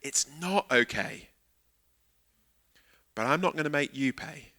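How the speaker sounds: sincere forgiveness. Shown as a demonstration. Preacher restating true forgiveness to the congregation, soft and earnest. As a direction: say it gently and firmly. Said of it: "Forgiveness is saying".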